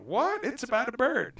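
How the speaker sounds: high incredulous what, then it's about a bird; mock surprise. Mocking the audience's disbelief, high surprised voice. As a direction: imitate a baffled listener. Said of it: "some of you are going".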